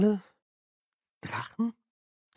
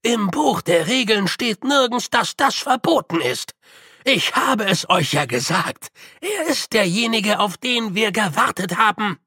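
put-on old man defending; 6 semitones higher than usual. A narrator's reading. Grütze defends Feucht.

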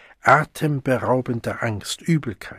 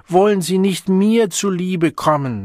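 higher, louder pleading call; coaxing bellow; 7 semitones higher, 4 dB louder than usual. A narrator's reading. Siegmund begging the Savage to come, higher coaxing shout. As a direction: beg sweetly but loudly. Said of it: "brüllte Siegmund schmeichelnd".

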